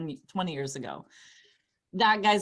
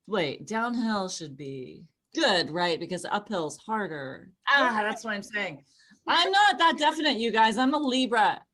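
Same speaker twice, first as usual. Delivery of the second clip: ordinary talk, nothing marked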